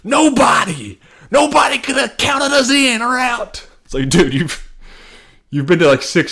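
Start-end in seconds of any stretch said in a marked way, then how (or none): 0.0-3.7 high, slow, hammed-up underdog speech; mocking Travis Kelce. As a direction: ham up a fake-humble victory cry. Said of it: "Travis Kelce was like".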